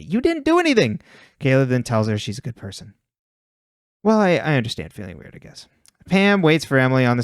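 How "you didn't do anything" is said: high-pitched exasperated objection; exasperated protest. Real, not acted. Host objecting to Spencer feeling guilty, exasperated protest. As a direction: pitch leaps up in protest at the absurdity.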